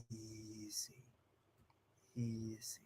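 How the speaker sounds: soft, low, slow "Easy"; calm easy. Shown as a demonstration. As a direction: ease the dog down quietly. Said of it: "move slowly and calmly".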